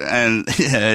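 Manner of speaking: ordinary talk, nothing marked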